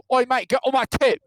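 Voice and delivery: high, loud mock British snap; British insult. Mocking British insults, mock British accent. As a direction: bark it like an offended British lad. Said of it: "Their insults are so bad".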